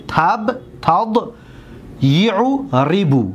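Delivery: slow matched pieces, تب beside تض, یعو beside ریبو; syllables compared. Shown as a demonstration. Teacher comparing two verb patterns, syllable by syllable. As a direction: set each syllable apart with small pauses. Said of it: "حرکت را دقت بتید".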